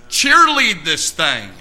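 loud, high exhortation, slow and driven; full-force preaching. Real, not acted.

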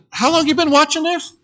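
high, disbelieving question; can't believe he just watched; incredulous. Retold or imitated. To the bystander, high incredulous question. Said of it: "And I said".

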